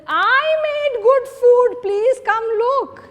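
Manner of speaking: loud, high, slow boastful call; showing off. Retold or imitated. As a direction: crow about your cooking to everyone. Showing off good food.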